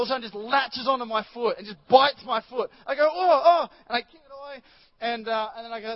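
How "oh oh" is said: higher, louder ouch; mock pained yelp. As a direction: yelp as the cat bites. Cat bites his foot.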